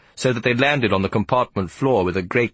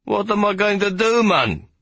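very high wailing plea; 13 semitones higher than usual. Acted. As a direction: wail it out helplessly. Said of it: "he wailed".

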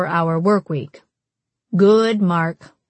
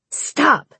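short, high-pitched hiss; hissed protest; 7 semitones higher than usual. A narrator's reading. Jude hissing back at Mallory's teasing, sharp protest. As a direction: cut it off with a hissed snap. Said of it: "hissed Jude back".

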